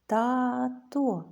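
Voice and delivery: «Тато» drawn out syllable by syllable; split into syllables. Shown as a demonstration. Teacher helps children count syllables, «Тато» slowly split. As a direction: say «Тато» as two long, separate syllables. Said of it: "Скільки у цьому слові складів".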